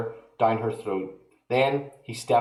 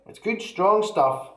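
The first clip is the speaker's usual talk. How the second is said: put-on higher boyish reassurance; calm boy; 9 semitones higher than usual. A narrator's reading. George reassuring grandma, higher. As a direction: brush it off like a cheeky lad.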